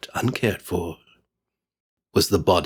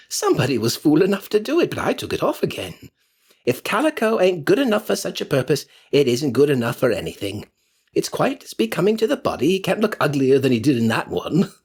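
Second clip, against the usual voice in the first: put-on higher, quick scoffing old woman; scornful charwoman; 6 semitones higher, faster than usual. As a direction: sneer at the dead man's waste. Charwoman scoffs, pitch raised.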